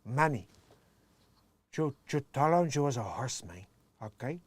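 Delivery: lightly voiced Cuban gangster accent; a hint of Tony Montana's Cuban accent; Scarface quote. Quoting Scarface to the viewers in a light Cuban accent. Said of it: "bring a Scarface reference back".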